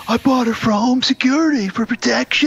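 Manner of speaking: high, put-on defensive husband; retelling husband justifying the gun to his wife; mock Cage. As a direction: ham up an excuse. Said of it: "He's like".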